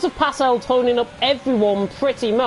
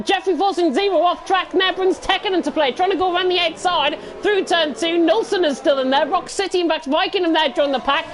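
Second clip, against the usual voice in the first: raised-pitch running race call; animated motorsport commentary; excited live play-by-play; 6 semitones higher than usual. Real, not acted. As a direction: keep the tension up as the battle runs on.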